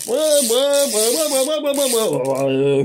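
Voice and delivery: high, slow drawn-out yeahs; delighted. Real, not acted. Enjoying the laugh therapy, drawn-out delighted yeahs. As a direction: savour each one, loving it.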